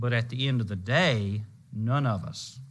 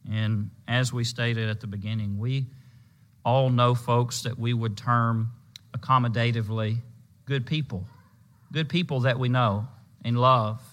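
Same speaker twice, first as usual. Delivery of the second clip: usual speaking voice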